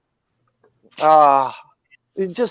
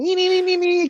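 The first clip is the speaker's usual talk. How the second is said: much higher sing-song taunt; taunting nee-nee; faster than usual. Retold or imitated. The mouse taunts the snake with a much higher sing-song nee-nee. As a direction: tease like a cheeky mouse kicking sand. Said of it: "the mouse just lay there going".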